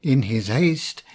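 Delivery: usual speaking voice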